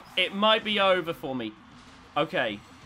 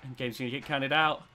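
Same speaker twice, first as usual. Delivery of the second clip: usual speaking voice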